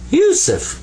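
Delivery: Yusuf sung out high and falling; proud name. Shown as a demonstration. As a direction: announce Yusuf with a bright high lift. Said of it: "That's how you write Yusuf in Arabic".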